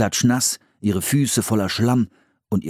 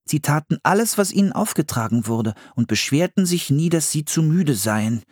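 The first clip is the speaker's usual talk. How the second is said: put-on higher boy voice reading from a book; boy reading aloud; 7 semitones higher than usual. A narrator's reading. Philipp reads the book to his sister, high and careful. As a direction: read it like a kid reciting facts.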